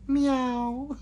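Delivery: high, stretched-out meow; mock meow. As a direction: play at being a cat. Repeats the joke cat noise.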